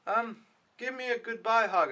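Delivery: lightly voiced kid asking for a hug; imitating little Ricky. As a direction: ask like a small boy wanting a hug. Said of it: "And he's like".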